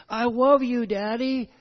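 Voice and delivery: put-on high, loud little child saying I love you; a small son to his daddy, high and loud; toddler voice. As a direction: play the toddler hugging your leg. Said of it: "Little toddler comes up".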